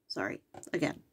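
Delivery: ordinary talk, nothing marked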